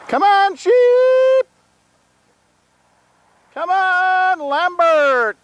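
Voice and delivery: high, loud yelling call across the field; calling out to goats. Real, not acted. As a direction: holler to call the herd in.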